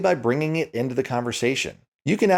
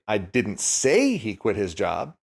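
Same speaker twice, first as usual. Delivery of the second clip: "say" rising and stretched, slowly; slow line, "say" lifted; stress on SAY. Shown as a demonstration. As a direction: lean on "say" in "didn't say". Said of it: "depending on which word you put the emphasis on".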